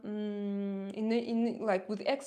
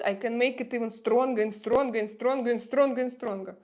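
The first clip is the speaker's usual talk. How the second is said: louder, hammed-up thick Russian accent, piling it on; exaggerated Russian accent; 5 dB louder, faster than usual. Shown as a demonstration. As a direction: lay the Russian accent on thicker with each stronger. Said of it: "I can make it even stronger if you want".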